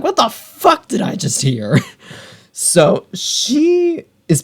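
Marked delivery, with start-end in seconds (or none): 0.0-1.8 stunned disbelief. Retold or imitated. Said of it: "Feyre's like".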